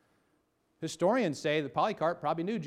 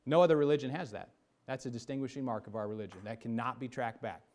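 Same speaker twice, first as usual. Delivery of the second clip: ordinary delivery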